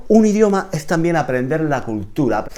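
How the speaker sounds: ordinary delivery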